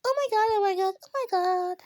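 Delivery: high, rapid repeated oh my god; excited. Real, not acted. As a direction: gush it out breathlessly. Game tension.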